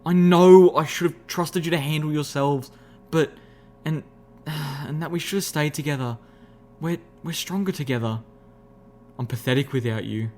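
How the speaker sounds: desperate apology. A narrator's reading. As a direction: confess and plead with your friends desperately. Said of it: "I looked at my friends desperately".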